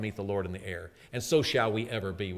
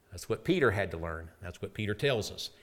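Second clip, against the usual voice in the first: usual speaking voice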